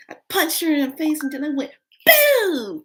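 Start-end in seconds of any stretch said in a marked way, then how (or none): 2.1-2.8 one high, loud boom; acted-out fight noise; kick sound effect. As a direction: shout the blow as it lands. Acting out a kick.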